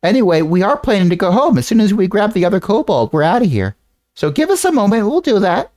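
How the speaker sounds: character voice. Acted. GM voices X answering the command.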